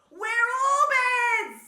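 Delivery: very loud, high, drawn-out yell; boisterous squirrel bellow; full shout. A narrator's reading. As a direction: holler it at full volume. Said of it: "shouted Squirrel".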